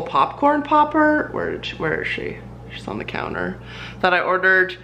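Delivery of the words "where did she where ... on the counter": quiet aside. Real, not acted. Searching for item, muttered aside.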